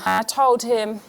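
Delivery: normal talking voice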